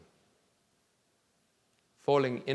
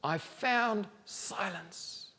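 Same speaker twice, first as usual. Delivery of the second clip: slow, softer, higher and savouring; contented hush; 8 semitones higher, 4 dB quieter, much slower than usual. A narrator's reading. Boy on the hill savouring his silence, slow and content. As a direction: breathe it out, relishing the calm.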